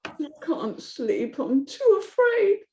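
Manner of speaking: slow, small scared voice; a sleepless frightened girl; afraid child. Acted. Amy afraid.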